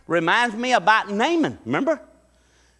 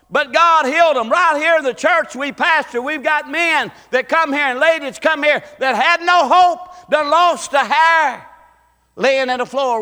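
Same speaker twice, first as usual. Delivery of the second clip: high, loud preaching climax; full-force preaching; 9 semitones higher, 8 dB louder than usual. Acted. Pastor preaches healing to his church, full force.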